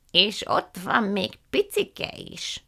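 put-on high, slow, drawn-out old lady voice; effusive old aunt. A narrator's reading. Aunt Fan welcoming the guests, slow and high. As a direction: stretch every word with delight.

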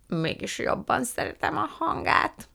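put-on high old aunt's character voice; Fan néni. A narrator's reading. Fan néni muses on honey plants, high character voice. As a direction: play a high-voiced, musing aunt.

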